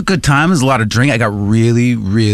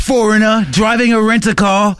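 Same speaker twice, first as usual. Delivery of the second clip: higher mock British voice; British tourist; 4 semitones higher than usual. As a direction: parody a British tourist's accent. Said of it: "old guy with a British accent".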